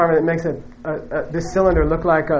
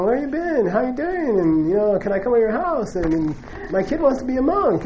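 high, softer eager greetings; eager old acquaintances; 5 semitones higher, 5 dB quieter than usual. Retold or imitated. Former coworkers crowd the returning teacher, high eager voices. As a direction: imitate gushing old coworkers. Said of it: "everyone's like".

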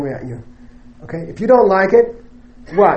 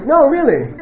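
high, laughing insistence; 8 semitones higher than usual. Real, not acted. Teacher insists to amused students, laughing.

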